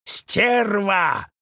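high angry shout from afar; furious yell. A narrator's reading. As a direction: yell the insult after her. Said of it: "яростный вопль".

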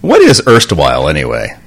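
loud puzzled what; puzzled question. Real, not acted. Co-host asks what erstwhile means, loud and puzzled. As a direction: ask the word back, baffled. Co-host quibbles over the word.

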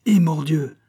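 loud, high swearing outburst; exclaimed oath. A narrator's reading. Bailly swears for the first time, exclaiming. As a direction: burst out with an indignant oath. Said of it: "s'écria Bailly".

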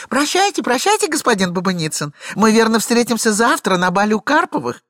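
high, loud, breathless excuses; chattering lady. Acted. Glafira rattling off excuses to her husband, high and fast. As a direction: talk him dizzy with a tale.

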